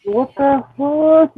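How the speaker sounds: ordinary talk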